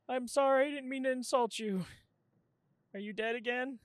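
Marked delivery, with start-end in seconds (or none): 0.1-1.9 high, loud rattled apology; shaken apology. Real, not acted. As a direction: still panicking, say sorry. Still shaken, apologising loudly.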